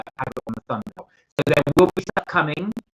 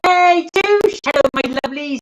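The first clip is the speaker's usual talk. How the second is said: loud, high excited hello; excited greeting; 9 dB louder than usual. Real, not acted. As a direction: greet a viewer with delight. Greeting a viewer in chat.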